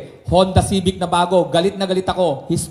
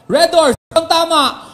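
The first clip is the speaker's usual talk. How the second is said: 大きく叫ぶビールCMのコピー; 高く大きくゆっくり叫ぶ; beer ad shout; 6 semitones higher, 4 dB louder, slower than usual. Shown as a demonstration. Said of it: "parang ganito lang".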